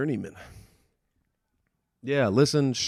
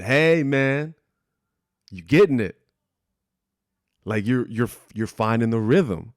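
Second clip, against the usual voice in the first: upbeat and raised, retelling a fan's hype; excited praise; 2 semitones higher, 5 dB louder than usual. Retold or imitated. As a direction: cheer him on like a thrilled fan. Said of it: "he was really excited too".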